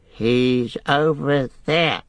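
high, slow creature cackle; Gollum cackling. Acted. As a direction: cackle the words, stretching them out. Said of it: "he cackled".

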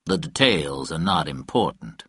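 low-pitched, cool dismissal; understated, cool and composed; coolly. A narrator's reading. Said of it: "Fitch said coolly".

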